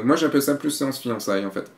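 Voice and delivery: usual speaking voice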